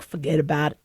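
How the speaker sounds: low, tossed-off New York accent. Shown as a demonstration. Host showing the accent picked up in New York, low mock New York accent. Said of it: "When I'm in New York".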